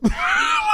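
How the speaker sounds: sudden squealing laugh at yourself; high-pitched laugh. Real, not acted. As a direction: crack up in a high burst. Cracks up at own accent.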